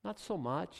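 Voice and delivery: soft, drawn-out deflating reply; gentle put-down. Acted. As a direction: let him down quietly, slightly amused. God deflating the boast.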